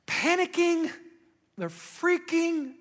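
dramatic emphasis. Real, not acted. Describing panic dramatically.

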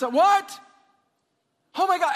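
alarmed shout. Acted. Pastor acting out a coworker's alarm, startled shout. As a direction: yelp it in alarm. Acts another person's alarm.

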